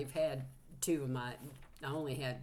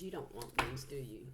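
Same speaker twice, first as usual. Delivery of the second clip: ordinary talk, nothing marked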